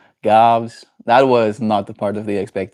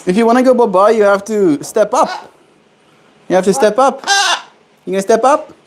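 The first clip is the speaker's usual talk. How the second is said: high coaxing pet talk; talking to a pet bird; 9 semitones higher, 3 dB louder than usual. Real, not acted. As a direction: coax the bird gently up.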